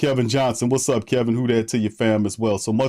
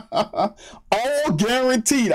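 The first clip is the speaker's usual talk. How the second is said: high, very slow, laughing; stunned amused drawl; laughing disbelief; 11 semitones higher, much slower than usual. Real, not acted. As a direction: drag it out through laughter. Laughing at the contract.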